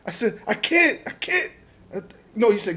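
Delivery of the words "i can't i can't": very high, loud retold plea; frantic protest. Driver answering armed agents, high frantic retold protest. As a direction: imitate yelling back helplessly.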